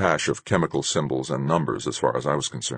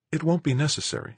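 female character voice; 12 semitones higher than usual. A narrator's reading. Narrator voices Marguerite.

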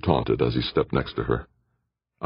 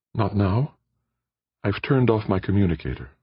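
higher, lighter female voice for Aditi; Aditi's voice; 6 semitones higher than usual. A narrator's reading. Aditi answers Jordan in her higher voice.

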